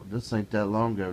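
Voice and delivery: normal talking voice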